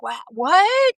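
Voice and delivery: high, baffled "what?"; mock bewilderment. Host playing baffled by somebody much smarter, mock bewilderment.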